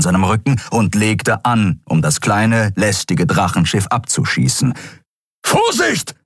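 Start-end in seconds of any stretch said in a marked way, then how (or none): 5.5-6.2 high, sudden cry of alarm; shouted warning. A narrator's reading. Kero warns the pilot of the shot, calling out. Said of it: "rief Kero aus".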